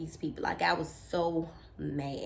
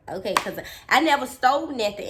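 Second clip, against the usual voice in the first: ordinary delivery, nothing marked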